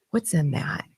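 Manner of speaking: breathy whispered question. Retold or imitated. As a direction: whisper it like a secret. Mock questioning drug makers.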